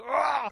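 high-pitched "Ah!" of pain; imitated pain scream. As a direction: mimic a man yelling over his hurt leg. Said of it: "he's screaming, he's holding his leg".

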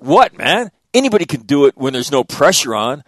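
loud, high mock taunt with a laugh; teasing dad. Dad taunts sons on the ladder, loud laughing tease. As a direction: goad them like a prankster father. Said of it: "I'm like".

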